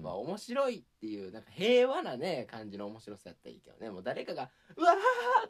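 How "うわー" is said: mock panicked person. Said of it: "悪意あるモノマネやな".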